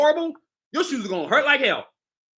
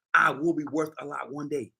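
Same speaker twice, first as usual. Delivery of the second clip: ordinary talk, nothing marked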